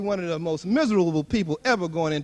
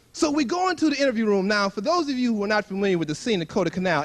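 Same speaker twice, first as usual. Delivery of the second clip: usual speaking voice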